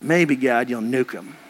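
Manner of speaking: low, muttered plea to nuke them; Jonah's grim wish. Retold or imitated. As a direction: Jonah hoping God destroys the city, low and dark. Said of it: "But Jonah's like".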